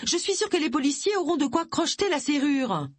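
high, hurried objection; urgent protest. A narrator's reading. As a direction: argue urgently through a closed door. Pleading hurriedly through the door.

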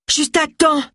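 high, panting arrival; high breathless character voice. A narrator's reading. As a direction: gasp it out in a high voice. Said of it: "haleta-t-il".

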